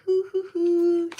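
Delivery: high, slow cooing hoots; warm fuzzy hoots. Real, not acted. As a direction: coo it out high and slow, full of joy. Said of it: "I'm feeling so warm and fuzzy".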